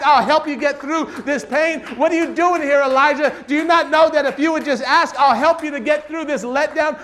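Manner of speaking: high, loud impassioned sermon delivery; full-force preaching. Real, not acted. Preacher confronts Elijah in God's words, full-force preaching. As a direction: drive each question home, loud and high.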